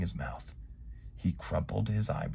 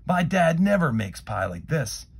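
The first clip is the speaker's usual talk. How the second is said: impressed; 8 semitones higher, 7 dB louder than usual. A narrator's reading. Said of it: "Jeremy said".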